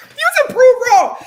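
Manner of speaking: high, loud, slow self-mocking echo; exaggerated shouted echo; loud mock repeat. Real, not acted. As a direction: shout it back at yourself, stretched out.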